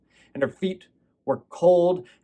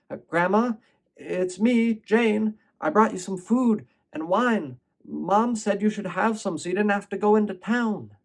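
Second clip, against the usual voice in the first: put-on a touch higher, eager little girl's voice; lightly voiced child calling out to grandma; little girl Jane; 7 semitones higher than usual. Jane speaks to her grandma.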